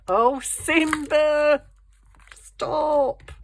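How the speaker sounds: high, loud, drawn-out call to a naughty pet; exasperated scolding shout. Real, not acted. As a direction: yell at the cat to stop. Shouting at her misbehaving cat.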